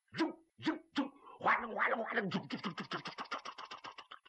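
rapid-fire whooshes and rattles fading away; mouth-made combat noises, speeding up and fading; imitated hammer whirling. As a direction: voice the weapons spinning, then let it die off. Imitates repeated hammer swings.

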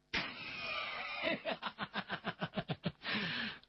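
high, drawn-out cackle trailing away in pulses; theatrical scheming cackle; long villainous laugh. Acted. Character laughs before explaining scheme.

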